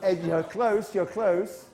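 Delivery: normal talking voice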